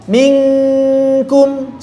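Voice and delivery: Minkum held with a thin, light kaf; model minkum. Shown as a demonstration. Tajwid teacher demonstrating Minkum, clear and thin. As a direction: say Minkum slowly for the class to copy.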